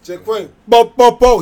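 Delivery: loud, high shout-out call. Real, not acted. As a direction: holler his name across the room. Shouting out a friend's name.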